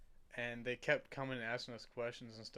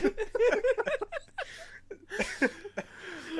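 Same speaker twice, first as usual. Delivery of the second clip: long high helpless laugh; 6 dB louder than usual. Real, not acted. Losing it over the story.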